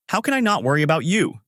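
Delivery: put-on a worried child protesting, understated; frantic. A narrator's reading. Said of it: "I get frantic as I say".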